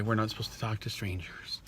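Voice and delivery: hushed whisper. Retold or imitated. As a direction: whisper it like a scared kid. Mock child reply, whispered.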